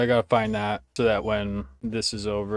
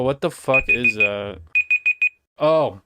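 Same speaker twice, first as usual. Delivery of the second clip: ordinary talk, nothing marked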